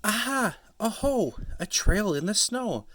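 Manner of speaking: high, loud excited little voice; bouncing child discovery. Acted. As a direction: call out like a thrilled explorer. Child exploring excitedly in snow.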